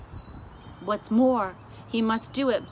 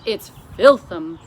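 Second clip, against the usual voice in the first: very loud disgusted cry; BFG disgusted cry; 14 dB louder than usual. A narrator's reading. The giant tells the Queen the coffee is vile, loud. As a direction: spit the word out in disgust. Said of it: "the B F G cried out".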